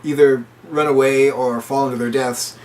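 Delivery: ordinary talk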